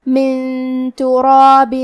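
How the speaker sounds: من تراب slow, nun hidden in a nasal hum before the ت; ikhfa nasal. Shown as a demonstration. Teacher demonstrates ikhfa in من تراب. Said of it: "حکم کو غنہ کے ساتھ ادا کریں گے".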